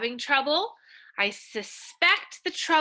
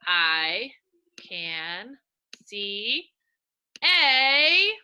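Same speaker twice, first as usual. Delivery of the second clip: slow reading aloud; 4 dB louder, much slower than usual. Shown as a demonstration. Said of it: "here we go".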